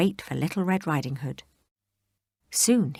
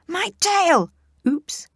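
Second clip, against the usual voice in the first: high loud yelp; yelp of pain; 14 semitones higher, 6 dB louder, much slower than usual. Acted. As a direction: yelp as your tail is stepped on. Wolf yelps.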